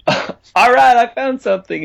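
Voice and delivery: amused realisation. Retold or imitated. Songwriter voicing the moment a funny song works, amused realisation. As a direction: let a laugh break into the sudden find. Voices own realisation, with a laugh.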